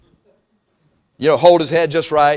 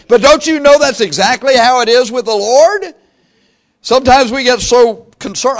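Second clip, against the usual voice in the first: rising preaching; 9 semitones higher, 8 dB louder than usual. Real, not acted. Preacher drives home point to congregation.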